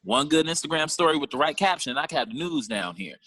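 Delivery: ordinary delivery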